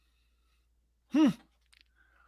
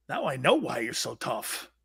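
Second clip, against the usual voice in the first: normal talking voice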